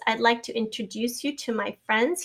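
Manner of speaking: normal talking voice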